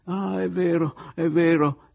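Oliva geme, voce alta e forte; lamentoso, quasi un pianto; gemito. Acted. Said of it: "gemette Oliva". (Oliva geme al narratore che ha ragione)

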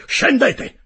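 very high, very loud bellowed order; commanding shout. Acted. Captain ending all questions, ordering him below with a shout. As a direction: bellow it to end the discussion.

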